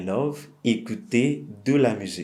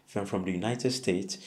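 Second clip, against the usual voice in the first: ordinary talk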